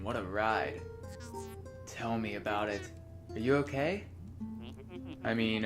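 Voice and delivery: softly reading dialogue lines aloud; reading game dialogue. Acted. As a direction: read the lines like a script. Reads both characters' dialogue.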